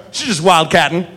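easy Southern understatement; imitating an unfazed small-town lawman; nonchalant Southern cop. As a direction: explain it away as no big deal. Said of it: "And he goes".

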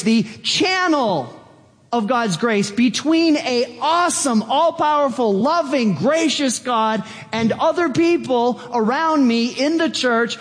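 high-pitched, exultant preaching; thrilled. Acted. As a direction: exclaim it in amazed delight. Acts out thrilled channel of grace.

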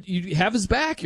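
usual speaking voice

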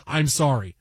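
low, deadpan banker apology; evil banker. Acted. Host as evil banker to ruined family, deadpan. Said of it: "the evil guy from the bank just says".

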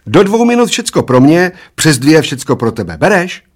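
high, loud, gleeful offer; merry. A narrator's reading. Old man proposes the bet, merry and loud. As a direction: pitch the bet high and merrily. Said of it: "Zaveselil se dědek".

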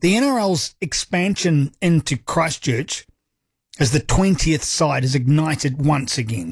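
ordinary delivery

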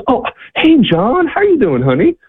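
very high, sing-out hello; delighted greeting. Retold or imitated. Rhonda snaps out of it hearing John, delighted high greeting. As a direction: imitate her surprised delight at a friend, pitch way up.

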